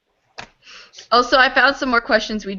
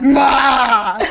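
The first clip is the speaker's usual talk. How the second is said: loud hammed-up villain laugh burst; evil laugh; 2 semitones higher, 4 dB louder than usual. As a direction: burst out in a mock villain cackle. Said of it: "we should do an evil laugh then".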